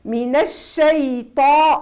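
الشيطان with an emphatic, heavy ط; الطاء مفخمة في الشيطان. Shown as a demonstration. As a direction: say الشيطان with a thick ṭa, not ta. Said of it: "طا ماهيش تا".

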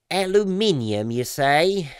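high, plummy British "Aluminium" mock surprise; mock posh British. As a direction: gasp at the British spelling like a toff.

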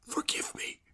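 soft unvoiced plea; whisper. Makar to Askionov at night, whispered plea. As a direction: whisper it close to his ear.

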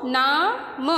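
word drawn out slowly; teacher blending slowly. Shown as a demonstration. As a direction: blend the sounds slowly into नाम. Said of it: "वो क्या बन गया है".

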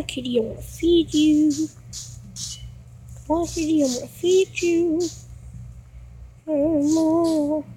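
ordinary delivery

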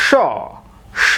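"স" as a long shaw; alternative pronunciation. Shown as a demonstration. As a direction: say "স" as shaw, open drawn-out vowel. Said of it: "আরও একটি উচ্চারণ রয়েছে".

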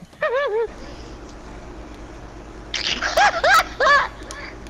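high breathless laugh; wheezing laugh. Real, not acted. Laughing at friend's trick.

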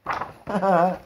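short laugh. Real, not acted. Laughs after the story.

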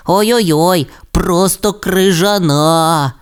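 змерзлий Расмус. Acted. Расмус тремтить від холоду.

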